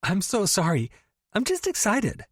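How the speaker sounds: overexcited friend teasing about the stepbrother; gushing best friend. Acted. Tori apologizing.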